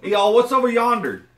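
loud, high hammed-up Southern twang. Friends joking about Southern talk, loud mock Southern twang.

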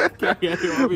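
high, laughing disbelief. Real, not acted. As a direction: laugh through the question. Laughing at joke.

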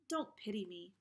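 ordinary delivery

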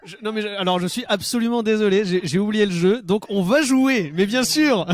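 loud, animated apology; emphatic apology. Real, not acted. Loud mock-solemn apology for forgetting.

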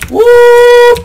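high, loud "woo"; high whoop. Real, not acted. Player cheering at his own game, high whoop.

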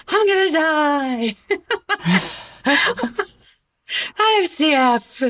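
high, drawn-out mock wail breaking into laughter; hammed-up despair, stretched out and whiny; mock dramatics. Mom imitating her daughter's dramatics, mock despair. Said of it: "with the dramatics".

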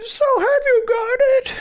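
eager high Chris voice. Acted.

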